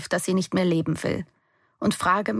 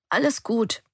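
hoarse croak from all the yelling; 5 semitones higher than usual. A narrator's reading. Daughter reassuring Live with a croaky voice. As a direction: croak it out, voice worn raw. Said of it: "krächze ich hinterher".

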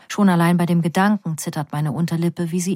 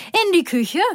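mother; 10 semitones higher than usual. A narrator's reading. Mother dancing, voiced high.

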